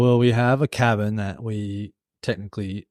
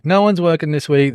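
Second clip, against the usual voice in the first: higher-pitched voicing of the boss's email; mock work email; 5 semitones higher, 4 dB louder than usual. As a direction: lightly act out the employer's notice. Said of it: "emailed me at the start of the week going".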